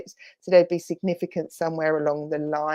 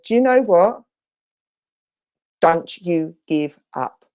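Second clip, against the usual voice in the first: louder insistent spirit message; firm insistence; 4 dB louder than usual. Retold or imitated. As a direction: tell her firmly not to quit. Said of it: "that was a bit strong".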